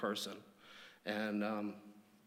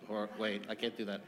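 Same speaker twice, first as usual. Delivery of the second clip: ordinary delivery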